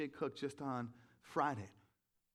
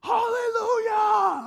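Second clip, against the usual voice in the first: much higher, much louder joyful shout; yelled hallelujah; 16 dB louder, much slower than usual. Retold or imitated. Runner shouting praise while passing on the lake walk, joyful yell. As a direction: shout it out like you can't hold it in. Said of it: "and I yell out".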